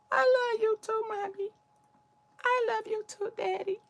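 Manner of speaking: put-on high, sweet little kid answering mommy and daddy; child's voice to mom and dad; small child voice. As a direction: play a tiny child saying I love you.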